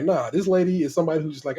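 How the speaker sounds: ordinary delivery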